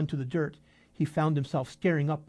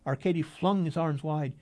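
plain reading voice

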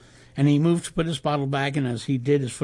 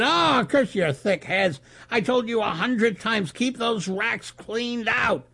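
angry shout; 8 semitones higher, slower than usual. A narrator's reading. Manager scolding bellmen over spilled bottles, restrained angry shout. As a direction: scold with exasperation, stretching the words. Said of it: "Mister Mandela shouted".

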